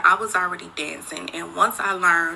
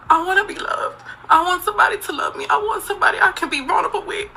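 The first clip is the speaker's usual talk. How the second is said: tearful, pitched-up pleading voice; crying; 10 semitones higher, faster than usual. Real, not acted. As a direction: let the tears come through while pleading. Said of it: "I'm crying".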